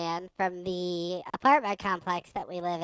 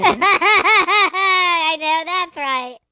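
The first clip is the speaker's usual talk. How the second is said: loud, high laughing reply; laughing hard; 10 semitones higher, 15 dB louder, much slower than usual. Real, not acted. Co-host cracking up at the Candyland joke, real laughter. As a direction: crack up, then agree through the laugh.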